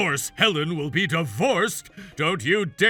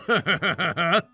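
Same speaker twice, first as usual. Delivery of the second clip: gloating laugh; 3 semitones lower, 5 dB louder than usual. Acted. Betrayed husband savoring the coming reckoning, gloating laugh. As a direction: chuckle low in triumph. Ryan gloats at arriving station.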